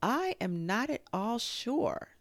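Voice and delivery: high, slow musing; slow, lilting deliberation; thoughtful. A narrator's reading. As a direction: ponder it aloud, drawing the words out. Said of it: "said Kanga in a thoughtful voice".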